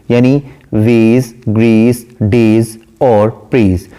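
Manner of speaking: slow, each rhyme word set apart; वेज, grace, डेज, praise each said slowly with a pause between; rhyme words spelled out. Shown as a demonstration. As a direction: list the rhymes one by one, slowly. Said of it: "जैसा कि first line का last word है".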